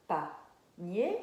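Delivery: PANIER in two separate beats, PA, then NIER lifted; syllable by syllable. Shown as a demonstration. Teacher repeating the example for children, syllable by syllable. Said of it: "la première syllabe".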